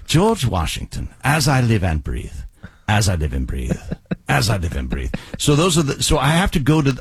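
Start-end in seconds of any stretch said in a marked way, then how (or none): 0.1-5.1 British royal accent, dropping low; King George. Shown as a demonstration. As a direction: proclaim it in a regal British accent. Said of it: "when I do King George the third".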